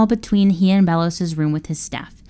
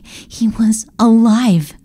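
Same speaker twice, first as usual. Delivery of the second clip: slow, higher, wondering; slow amazed realization; awed; 4 semitones higher, 3 dB louder, much slower than usual. A narrator's reading. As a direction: let the words land in awe. Joyful discovery.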